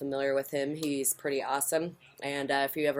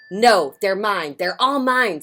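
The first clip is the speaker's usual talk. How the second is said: loud, higher possessive whine; grouchy ladybug; 5 semitones higher, 9 dB louder, slower than usual. Acted. Grouchy ladybug refusing to share, loud and petulant. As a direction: insist it's all yours, petulant and loud.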